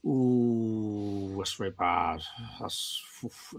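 low, soft uneasy groan trailing into breath; mock disapproving wince. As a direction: play a pained disapproving wince, fading to a breathy oof. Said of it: "And I'm going".

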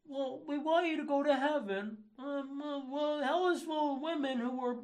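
high, mocking boyfriend excuse; hesitant parody of a boyfriend explaining himself; placating boyfriend. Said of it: "excuse it to your girlfriend".

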